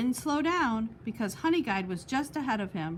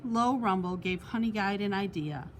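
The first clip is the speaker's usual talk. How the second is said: usual speaking voice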